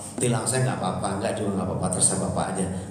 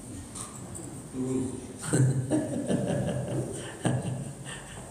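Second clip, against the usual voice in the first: soft laugh; 7 dB quieter than usual. Real, not acted. Audience-facing joke.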